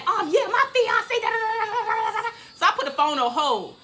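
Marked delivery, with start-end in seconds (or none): 0.1-2.3 high, rapid-babble caller tirade; high-pitched gibberish cussing; mock screaming rant. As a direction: imitate a woman yelling down the phone. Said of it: "this girl is screaming, cussing, yelling".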